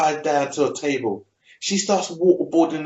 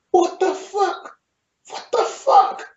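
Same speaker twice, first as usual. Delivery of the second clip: very high, squeaky-pitched shock; mock stunned disbelief. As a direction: act out aghast disbelief, way up high. Voices Tommen's shock at the fire.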